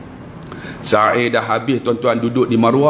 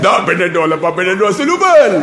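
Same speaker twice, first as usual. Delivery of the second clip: raised, emphatic comic protest; mock indignant denial; 4 semitones higher than usual. Ustaz denying the Panadol trick to pakcik, mock indignant. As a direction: deny it hotly, for laughs.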